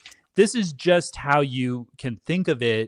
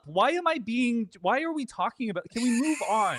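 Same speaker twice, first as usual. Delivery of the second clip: flustered; 9 semitones higher, 4 dB quieter than usual. Real, not acted. Flustered, wants topic changed.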